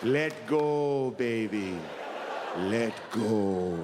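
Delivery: low, slow, tender hush; soothing deathbed comfort. Pretend. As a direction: ease him gently into letting go.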